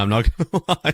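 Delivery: ordinary delivery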